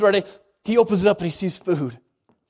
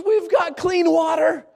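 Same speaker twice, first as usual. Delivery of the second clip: amazed; 6 semitones higher, slower than usual. Retold or imitated. Man marvels at running water.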